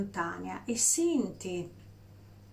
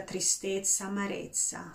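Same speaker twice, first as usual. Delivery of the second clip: normal talking voice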